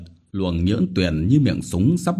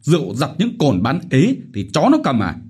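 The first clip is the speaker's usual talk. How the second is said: furious yell, higher and louder; 7 semitones higher, 5 dB louder, faster than usual. Acted. Village chief yelling at debtors, furious shouting. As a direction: bellow orders at the peasants.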